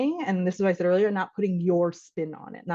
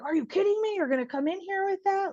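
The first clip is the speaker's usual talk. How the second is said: mock-defensive husband, pitched way up; 12 semitones higher, 4 dB quieter than usual. Husband hit with blame, voiced defensive and high. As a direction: play the husband snapping back, high and indignant. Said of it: "he's immediately like".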